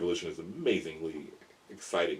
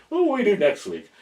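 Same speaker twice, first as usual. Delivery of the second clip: eager wondering; 13 semitones higher, 9 dB louder than usual. Retold or imitated. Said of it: "I'm, like, looking at".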